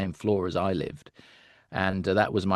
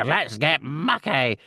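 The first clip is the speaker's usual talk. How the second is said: slow, raised Muck catchphrase, "mucky" dropping; UK Muck line; 4 semitones higher, slower than usual. Retold or imitated. Actor recalling the UK take of Muck, slow. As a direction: stretch "mucky" out like the cartoon digger. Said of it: "They go".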